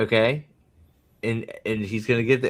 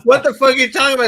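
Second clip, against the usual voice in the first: loud, high, rapid-fire mock outrage; indignant outburst; 10 semitones higher, 8 dB louder, much faster than usual. Retelling a captive's disbelief to co-hosts, mock outrage. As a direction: act out an incredulous yell, fast and high.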